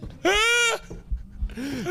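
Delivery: big laugh. Real, not acted. Friend's belly joke.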